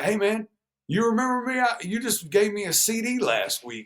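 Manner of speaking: high, eager fan voice on the phone; excited caller voice. Retold or imitated. Fan calling back the rapper, high and eager. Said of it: "he'd be like".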